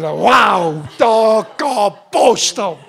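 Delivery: loud, slow, drawn-out amazement; amazed wow. Pretend. Preacher showing the congregation awe, loud and slow. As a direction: act out being blown away. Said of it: "Ich tu das so darstellen".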